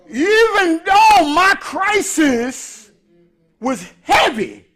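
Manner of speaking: slow, drawn-out, loud preacher build; preaching climax. Acted. Preacher to congregation, slow loud sermon build. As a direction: stretch each phrase like a sermon climax.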